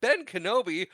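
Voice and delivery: high, startled echo of the name; mock surprise. Leia hearing who came along to rescue her, mock surprise. Acting Leia's startled recognition.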